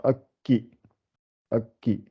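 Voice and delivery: "akki" with a gap at the k; doubled k. Shown as a demonstration. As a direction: softly split "akki" on a held k. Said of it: "ça rajoute toujours".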